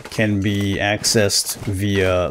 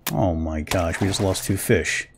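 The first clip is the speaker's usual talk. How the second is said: ordinary delivery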